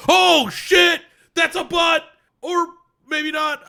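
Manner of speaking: loud, high yelp of shock; shocked shout. Retold or imitated. Tojima yelling in shock as she drops her skirt. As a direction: blurt it out, stunned and loud.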